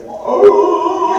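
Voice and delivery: high, surprised little oh as the dog; the startled husky at a car alarm; mock startled dog. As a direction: voice the dog's startled oh. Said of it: "and she was like".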